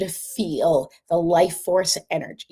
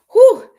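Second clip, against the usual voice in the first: big exhale whew; 14 dB louder than usual. Real, not acted. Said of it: "I'm, like, sweating".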